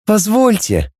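high, cooing robot voice; cooing robot girl. A narrator's reading. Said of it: "проворковала роботесса".

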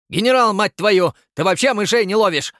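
high, loud enraged yell; furious shout. Acted. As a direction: scream at the general. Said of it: "В ярости прокричал Черчесов". (кричит в ярости)

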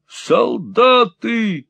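drawn-out high cry; slow high exclamation; exclaimed. Acted. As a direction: cry the word out slowly, high. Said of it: "вскричал мужчина".

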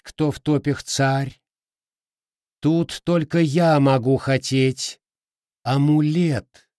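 high, haughty swamp king; bubbly creature voice, boastful; gurgling swamp creature. Acted. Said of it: "словно булькала болотная вода".

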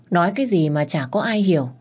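plain reading voice

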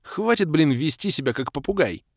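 irritated outburst; angry. A narrator's reading. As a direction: tell her off, fed up. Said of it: "разозлился я".

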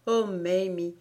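ordinary delivery, nothing marked

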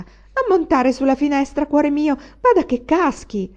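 old servant's hammed-up worried plea; comically frightened. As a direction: scold him off the windowsill in pretend panic. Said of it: "comicamente spaventata".

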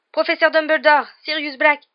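high, loud, breathless urgency; urgent call. A narrator's reading. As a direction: grab the headmaster's attention. Boy urgently calls to headmaster. (appel pressant)

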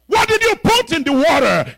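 high loud shriek; shrieking spirit. Retold or imitated. The spirit crying out at the bishop, high and loud. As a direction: shriek it in alarm.

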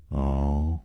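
low, soft, unimpressed "oh"; cool contempt. A narrator's reading. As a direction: acknowledge it quietly with scorn. Villain answers with cold contempt. (轻蔑地低声应一声)

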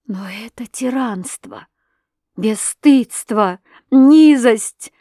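slow, rising outrage that bursts out; slow words swelling into a loud outraged cry; outraged shout. A narrator's reading. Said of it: "закричала я".

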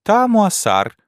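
high, mock French mimicry of his pronunciation; old lady mocking husband's pronunciation; mocking his accent. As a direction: parrot his bad accent back. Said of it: "передразнивая моё произношение".

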